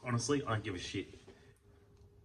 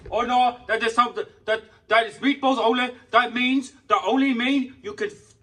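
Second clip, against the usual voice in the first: pitched way up and loud, a whiny mock of the worker; high, loud sneering imitation of a rude counter guy; mocking the shop worker; 12 semitones higher, 11 dB louder than usual. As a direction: mimic the shop worker snapping back, hammed-up. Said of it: "It's like".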